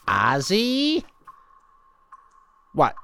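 usual speaking voice

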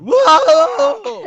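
very high, loud frog screech; screaming frog imitation. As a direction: screech like a frog that can't croak. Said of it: "sitting out in your lawn going".

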